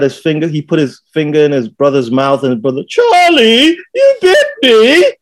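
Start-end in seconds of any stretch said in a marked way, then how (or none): 2.9-5.1 put-on very high, whining child's cry; little British kid. Little boy to the brother who bit him, imitated wail. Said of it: "This little British kid".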